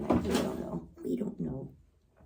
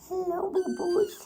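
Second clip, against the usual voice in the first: cooing pet talk; 7 semitones higher than usual. Real, not acted. Owner calling to the kitties, high cooing. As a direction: coo a pet name in a raised voice.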